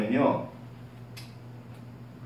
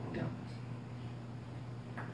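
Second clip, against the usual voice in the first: soft whisper; 21 dB quieter, much slower than usual. Real, not acted. Tender reassurance about God.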